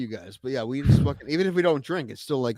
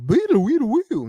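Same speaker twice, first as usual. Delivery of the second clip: high mock sound effect noise; silly sound effect; 9 semitones higher, 4 dB louder than usual. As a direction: squeak out a goofy sound effect. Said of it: "every time we say something like".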